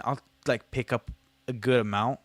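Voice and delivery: usual speaking voice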